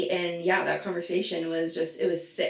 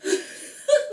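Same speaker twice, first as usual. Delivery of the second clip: shocked laugh; 3 dB louder than usual. Retold or imitated. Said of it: "she's like".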